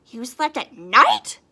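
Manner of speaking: loud, slow shocked gasp; gasped question. Acted. Another bat gasps at her story, loud. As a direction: gasp it out in disbelief. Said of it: "gasped another".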